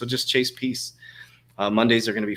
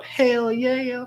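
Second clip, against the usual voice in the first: much higher, louder hype shout; hyped yes; 4 dB louder than usual. Real, not acted. Answering a viewer about XRP going up, a high excited shout. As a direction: yell it out like a crypto cheer.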